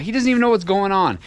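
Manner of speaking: usual speaking voice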